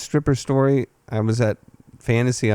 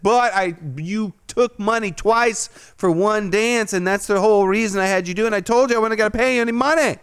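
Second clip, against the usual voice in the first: heated protest; 8 semitones higher than usual. Retold or imitated. Arguing double payment.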